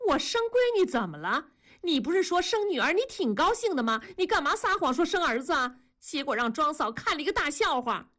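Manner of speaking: high-pitched indignant wife; angry reproach. Acted. Wife quarrels with husband over his lie. (高声气愤地质问丈夫)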